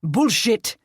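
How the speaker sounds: loud, high roar; furious roared denial. Acted. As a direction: roar it at him. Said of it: "Nick roared".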